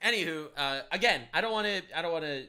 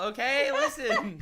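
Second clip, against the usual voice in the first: laughing; 8 semitones higher, 3 dB louder, much slower than usual. Real, not acted. Laughing mock defence.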